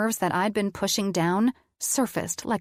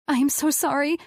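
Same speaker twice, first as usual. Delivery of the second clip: high, upset plea; tearful apology; 8 semitones higher, 3 dB louder than usual. A narrator's reading. Woman apologizing to her partner mid-panic, tearful. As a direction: apologize high-pitched, on the edge of crying. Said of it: "I started crying".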